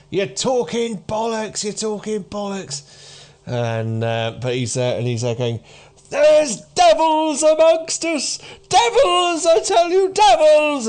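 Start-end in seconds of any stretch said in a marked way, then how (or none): 0.1-2.8 high-pitched jeering from the crowd; dismissive hecklers. Acted. Sparse audience waving off a street speaker, heckling. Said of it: "waving their hands at him, going". 6.1-10.9 high, loud, impassioned warning cry; shouting doomsayer. Acted. Aged man warning a scornful crowd of devils, shouting. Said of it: "the guy that he's shouting".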